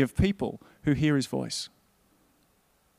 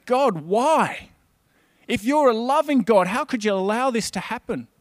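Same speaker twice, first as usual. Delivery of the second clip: raised, pleading voice, higher and louder; anguished cry to God; 5 semitones higher, 4 dB louder than usual. Retold or imitated. Preacher voicing oppressed Israel crying out to God, raised and pleading. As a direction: cry out to God in pain and protest.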